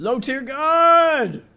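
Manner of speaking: high, loud, drawn-out announcer yell; long, loud hype call; hyped shout. Real, not acted. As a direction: belt out the name, stretching the last word. Hyped announcing of a streamer's name.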